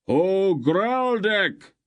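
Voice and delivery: high, slow, loud hail; roaring call. A narrator's reading. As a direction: roar it over a crowd. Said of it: "he roared in a huge voice".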